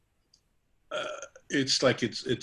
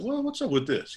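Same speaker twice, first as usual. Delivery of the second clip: pitched up high; playful; 12 semitones higher than usual. Retold or imitated. Teasing the barbers about a cut, high and playful. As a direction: tease the barbers.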